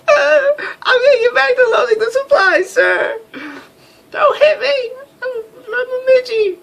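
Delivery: mock her like a scared crybaby; whimpering mousy voice. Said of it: "She looks like".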